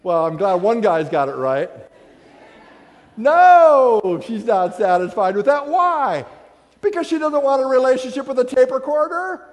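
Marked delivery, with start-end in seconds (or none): 3.2-4.2 emphatic no. Real, not acted. Answering his own question.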